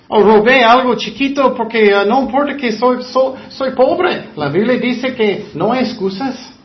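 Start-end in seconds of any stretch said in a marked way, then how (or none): 0.1-4.3 higher, louder whiny mock excuse. Preacher to congregation, parodying a thief's excuse, higher and louder. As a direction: ham up a petty thief justifying himself.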